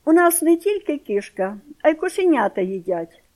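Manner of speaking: loud, high scornful retort; contemptuous. A narrator's reading. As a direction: toss it off with disdain. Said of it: "кажу я зневажливо". (зневажливо)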